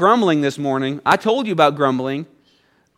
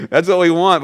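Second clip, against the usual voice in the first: usual speaking voice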